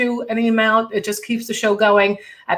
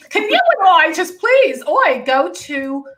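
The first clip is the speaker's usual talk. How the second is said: higher-pitched, half-laughing plea; playful pleading; 8 semitones higher, 3 dB louder, slower than usual. Real, not acted. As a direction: beg eagerly, drawing out the last words. Begging co-guest to collaborate, escalating repeat.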